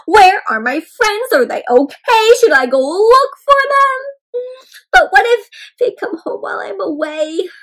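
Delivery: high, loud fretting; worried egg. Acted. Shell frets about his missing friends, high and loud. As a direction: fret out loud for lost friends.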